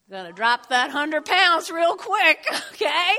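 laughing through the punchline. Real, not acted. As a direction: chuckle while delivering the joke. Joke, laughing at own punchline.

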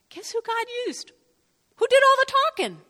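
incredulous question. Real, not acted. Rhetorical, high pitched.

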